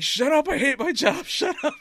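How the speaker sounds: hammed-up frustrated GM yelling; mock exasperated yell. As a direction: bark it like a fed-up boss, laughing at the end. Regal voiced yelling at brawlers.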